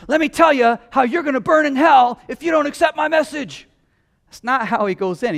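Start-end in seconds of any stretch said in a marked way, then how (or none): 0.1-3.7 high shouted mock hellfire warning; hellfire threat. Preacher mocks a judgmental witness, shouting high. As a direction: yell it like a condemning street preacher. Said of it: "go in and say".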